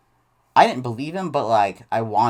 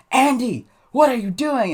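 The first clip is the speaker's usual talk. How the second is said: high, loud scolding shout; mock angry principal; 11 semitones higher, 6 dB louder than usual. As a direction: call the boy out like a furious principal. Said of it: "the principal was mad".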